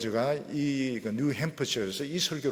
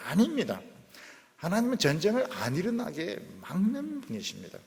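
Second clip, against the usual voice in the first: ordinary delivery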